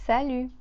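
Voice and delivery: salut ending on the vowel, T silent; French salut, no T. Shown as a demonstration. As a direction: say salut without the final T. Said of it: "we don't hear the T here".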